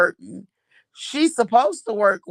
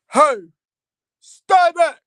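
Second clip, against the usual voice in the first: loud put-on officer command; mock deep voice; 7 semitones higher, 8 dB louder, slower than usual. Mom imitating son's new guard voice, loud mock authority. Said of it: "he started talking this deep voice".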